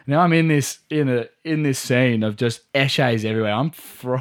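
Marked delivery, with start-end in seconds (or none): none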